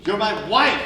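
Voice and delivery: high, loud indignant shout; angry outburst. Acted. Husband shouting at his wife who won't leave jail, angry outburst. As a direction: demand it, outraged at her refusal.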